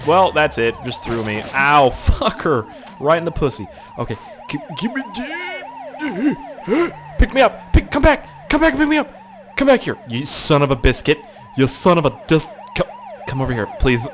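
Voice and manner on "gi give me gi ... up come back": much higher, squeaky desperate calls; frantic pleading. Real, not acted. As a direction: beg the car to come back. Stranded, begging the driver.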